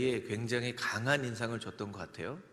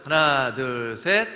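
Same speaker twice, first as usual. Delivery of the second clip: booming count to lead everyone together; loud count-in; 2 semitones higher, 11 dB louder, much slower than usual. Real, not acted. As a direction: count the crowd in loudly. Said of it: "우리 같이 해보겠습니다".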